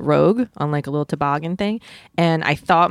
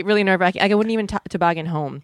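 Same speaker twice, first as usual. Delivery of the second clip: usual speaking voice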